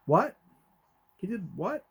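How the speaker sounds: high, rising startled "what?"; retold disbelief. Father overhearing daughter's phone conversation, retold disbelief. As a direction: re-enact being taken aback, pitch leaping up. Reacting to overheard shocking news.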